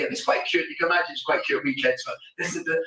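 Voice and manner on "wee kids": normal talking voice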